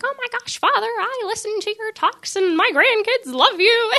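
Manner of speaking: high-pitched imitation of fawning old ladies; gushing old ladies. Old ladies greeting Father Mike, gushing in mock high voices. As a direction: put on a high, adoring old-lady voice. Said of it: "there's these old ladies".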